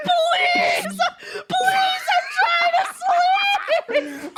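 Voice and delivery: high, imitated whine through laughter; frantic pleading. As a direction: beg desperately in a mock wail. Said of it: "Mari gets more frantic".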